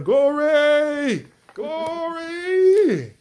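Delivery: high, long-held church shout of glory; exultant shout. Shown as a demonstration. Preacher showing how they love to shout the term, exultant shout. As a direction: shout it out loud, stretching the last vowel. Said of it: "I love to shout the term".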